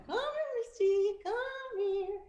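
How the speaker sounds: high sing-song call to the dog; sweet dog call. Retold or imitated. Owner calling the dog in a high sing-song. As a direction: coax the dog over sweetly. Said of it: "they understand tone".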